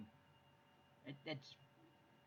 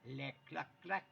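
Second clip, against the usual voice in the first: French chicken; 2 semitones higher than usual. Retold or imitated. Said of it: "the chickens".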